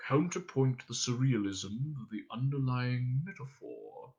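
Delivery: soft, pensive character voice; musing to himself. A narrator's reading. Captain pondering his poetry, quietly musing. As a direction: turn it over softly for yourself alone. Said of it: "mused to himself".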